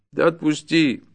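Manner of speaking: desperate peasant appeal; urgent plea. Acted. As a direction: beg insistently to be released. Peasant begs again.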